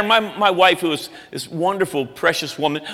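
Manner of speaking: ordinary talk, nothing marked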